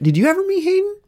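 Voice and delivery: high, excited question; bragging with glee. Real, not acted.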